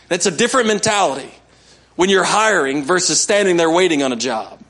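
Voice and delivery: ordinary delivery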